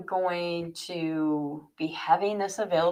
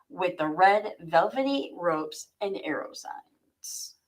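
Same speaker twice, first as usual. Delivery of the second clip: ordinary delivery, nothing marked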